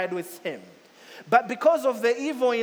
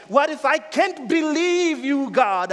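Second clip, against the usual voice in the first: raised, slowed, pleading cry to God; desperate plea; 7 semitones higher, 7 dB louder than usual. Acted. Doubter crying out to God, pleading loudly. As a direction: beg God loudly for an answer.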